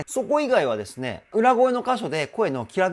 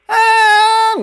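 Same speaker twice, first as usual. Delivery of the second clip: very high and much louder punchy note; powerful mix voice; 14 dB louder than usual. Shown as a demonstration. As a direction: hit the high note with force. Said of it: "やわやわーって感じじゃなくて".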